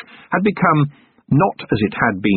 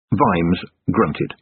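ordinary delivery, nothing marked

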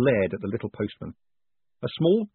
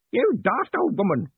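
put-on high, loud indignant old man; yelled; 11 semitones higher, 5 dB louder than usual. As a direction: yell the insult, pitched up. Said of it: "Groat yelled".

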